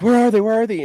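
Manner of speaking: high, loud frantic "where are they"; frantic demand. Retold or imitated. Bear frantically demanding the willows from the shop guy, high frantic shout. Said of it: "He's like".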